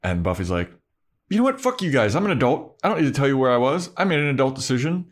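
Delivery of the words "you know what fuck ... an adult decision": high, fast mock-indignant tirade; defiant. Imitating Buffy facing skeptical onlookers, indignant and defiant. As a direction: rattle it off like an affronted young adult.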